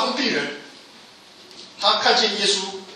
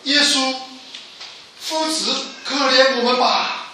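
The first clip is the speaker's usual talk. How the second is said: raised, higher voice calling for mercy; loud pleading cry; 6 semitones higher than usual. Retold or imitated. Four lepers crying out to Jesus from afar, loud plea. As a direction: call out high and loud, begging. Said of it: "这四个人一起高声说".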